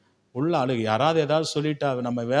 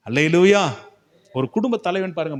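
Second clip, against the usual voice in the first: ordinary delivery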